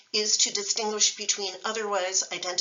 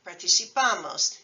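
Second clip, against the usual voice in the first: participamos with the stress moved to PA; shifted stress; 3 dB louder than usual. Shown as a demonstration. Teacher showing the stress shift, next-to-last syllable. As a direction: hit PA in participamos. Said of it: "Notice how the stress shifts".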